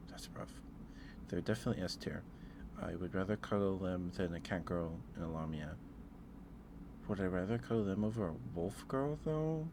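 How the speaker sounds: ordinary talk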